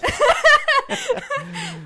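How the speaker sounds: high, loud giggling fit; a long bashful giggle; embarrassed giggle. Real, not acted. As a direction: laugh it off, flustered. Embarrassed about her celebrity crush.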